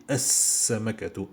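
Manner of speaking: "As-Samakatu" with a long doubled s and no L; as-samakatu, hissing s held; L assimilated. Shown as a demonstration. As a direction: go straight into the s of "As-Samakatu". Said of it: "je dis pas L".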